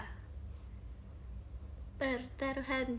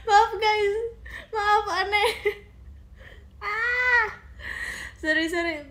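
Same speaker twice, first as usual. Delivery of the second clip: high, loud, breaking into laugh-sobs; laughing to tears; 8 semitones higher, 7 dB louder than usual. Real, not acted. Streamer apologising to viewers, laughing close to tears. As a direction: apologise while laughing so hard it nearly turns to crying. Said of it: "Duh, mau nangis".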